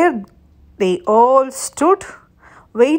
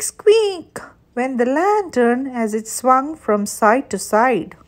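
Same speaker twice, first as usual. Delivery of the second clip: plain reading voice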